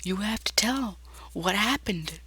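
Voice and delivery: slow, high, hushed character voice; quiet high-pitched insistence; high eerie figure. Acted. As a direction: say it softly up high, unhurried. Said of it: "She says".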